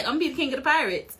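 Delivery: quick, offhand toss of the line; nonchalant. Retold or imitated. Fan acting out Luffy meeting people, super chill. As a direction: say it fast like it's no big deal. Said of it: "super chill and so nonchalant".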